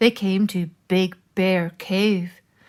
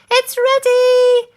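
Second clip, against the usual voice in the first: very high, loud, slow triumphant shout; 10 dB louder, slower than usual. Acted. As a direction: announce it at the top of your voice. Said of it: "she shouted".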